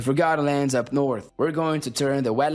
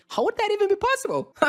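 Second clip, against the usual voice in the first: incredulous; faster than usual. Acted. Incredulous acted reply to friend.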